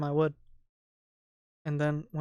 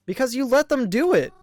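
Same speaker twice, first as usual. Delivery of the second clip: raised, accusing plea; hurt anger; 9 semitones higher, 10 dB louder than usual. Acted. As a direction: push back at him, wounded. Mary arguing back.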